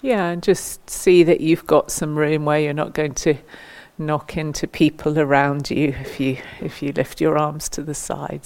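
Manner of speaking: ordinary talk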